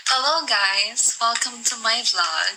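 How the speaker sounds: slow, drawn-out YouTube intro; vlogger voice. Pretend. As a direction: greet the viewers like a polished vlogger. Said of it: "Ganda nga ng boses mo". (mabagal na boses ng vlogger)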